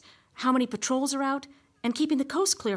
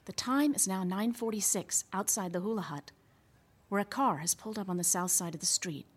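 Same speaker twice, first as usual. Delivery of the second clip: news report; 4 dB quieter than usual. Acted.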